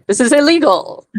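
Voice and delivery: high, loud mock indignation; mock protest. Podcaster voicing Mellis objecting to his abductor, mock-indignant.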